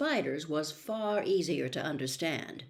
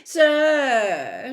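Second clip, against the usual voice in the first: louder, higher call of sir; Malfoy loudly calling to Snape; 6 semitones higher, 11 dB louder than usual. Acted. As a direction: call out to the teacher, loud and smug. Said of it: "said Malfoy loudly".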